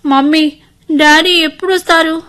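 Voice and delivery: put-on high, plaintive little-girl voice; pitiful child. Injured girl asking mother for daddy, pitifully. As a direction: plead like a forlorn child. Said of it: "దీనంగా అడుగుతోంది సిరి".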